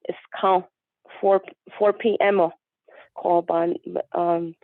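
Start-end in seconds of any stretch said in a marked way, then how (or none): none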